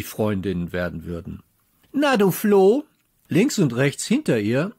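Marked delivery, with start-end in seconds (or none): 1.9-2.8 high, loud sneer; mock the little one from above; taunting. A narrator's reading. Bully girl taunts the new girl, high and loud.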